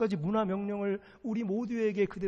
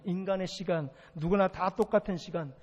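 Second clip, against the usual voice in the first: ordinary talk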